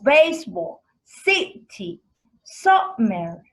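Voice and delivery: example words said loud with a strong first beat; stressed syllables. Shown as a demonstration. Teacher gives stress examples to the class, each word punched. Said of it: "the first syllable is usually stressed".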